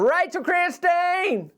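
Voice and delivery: soaring high, drawn-out announcement of the name; grand name call. Acted. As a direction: belt the name out like presenting a star. Proudly calling out a favorite doll.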